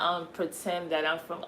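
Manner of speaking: ordinary talk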